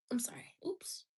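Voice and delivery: soft whispered sorry; whispered apology. Real, not acted. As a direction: apologize in a hush. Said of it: "That was a little aggressive".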